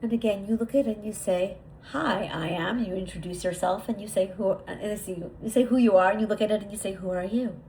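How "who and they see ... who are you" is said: ordinary delivery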